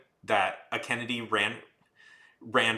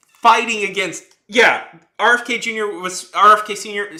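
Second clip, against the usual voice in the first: loud, higher heated rant; raised angry voice, building the case; 9 semitones higher, 9 dB louder than usual. Real, not acted. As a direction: hammer the facts in outrage. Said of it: "this gets me mad".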